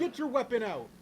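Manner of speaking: high, loud urgent order; exasperated yelling. Real, not acted. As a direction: shout the command at the character. Yelling orders at game character.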